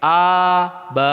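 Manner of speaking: A stretched long, then ba; drawn-out wrong syllable; wrongly lengthened. Shown as a demonstration. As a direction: demo of the mistake of lengthening A.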